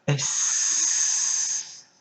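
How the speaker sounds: S with a very long hissed ending; drawn-out letter. Shown as a demonstration. Teacher sounding out a letter for pupils, long hissing S. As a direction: hold the sss of "S" for seconds.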